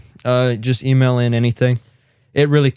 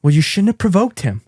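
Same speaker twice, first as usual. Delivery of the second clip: high scolding mom voice; 6 semitones higher than usual. Retold or imitated. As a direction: take the stepdad's side, scolding. Voicing the scolding wife.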